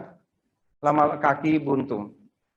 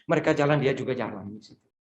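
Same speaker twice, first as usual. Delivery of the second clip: usual speaking voice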